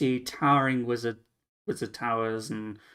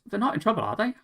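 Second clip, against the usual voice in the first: worried barkeep; 10 semitones higher, much faster than usual. Acted. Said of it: "she goes".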